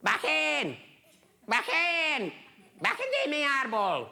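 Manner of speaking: high, sustained scolding cry; angry old woman. Acted. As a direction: holler up at brats in a high voice. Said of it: "she'd get so angry".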